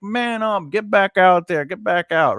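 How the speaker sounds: lightly acted push to get back out there; father's tough order.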